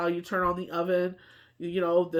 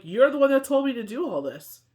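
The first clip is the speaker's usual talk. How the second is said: higher, louder retort; indignant retort; 6 semitones higher, 6 dB louder than usual. Retold or imitated. Mother snapping back at the doctors, higher and louder. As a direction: throw the blame back at them. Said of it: "I'm like".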